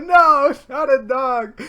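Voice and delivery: high, loud, slow refusal; panicked yell. Retold or imitated. Son refusing mom, slow panicked yell. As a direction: shout no in panic. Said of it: "And I go".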